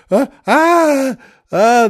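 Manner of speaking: high, loud gasping nun; frantic gasps. Retold or imitated. Shocked nun clutching her chest, high frantic gasps. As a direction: gasp in mock shock, pitch way up. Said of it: "she's almost frantic".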